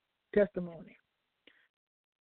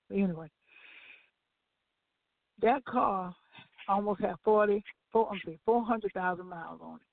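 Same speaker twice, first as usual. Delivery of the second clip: ordinary talk, nothing marked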